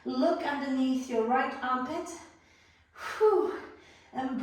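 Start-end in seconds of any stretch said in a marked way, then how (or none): 3.2-3.5 high loud exhale of relief; relieved whew. Real, not acted. After a hard pose.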